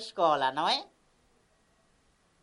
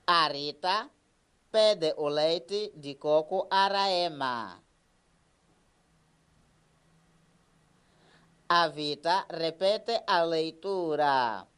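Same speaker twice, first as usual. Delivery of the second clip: put-on slow, clear sentence for children to repeat; slow model reading; 3 semitones lower, slower than usual. Shown as a demonstration. Teacher reads slowly so pupils repeat. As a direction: read it slowly, syllable by syllable. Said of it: "vamos repetir comigo algumas frases".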